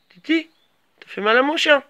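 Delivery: sharp call across the room. Real, not acted. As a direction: call the cat to stop. Calling the cat off the dog.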